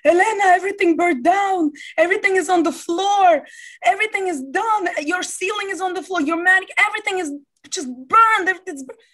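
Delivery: put-on high, loud, broken Greek-accented English. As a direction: imitate a panicking Greek landlord shouting the news. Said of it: "this Greek broken English".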